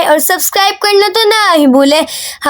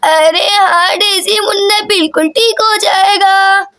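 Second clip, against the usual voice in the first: doting grandmother. Acted. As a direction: stretch the words like a grandmother. Grandmother repeats the reassurance.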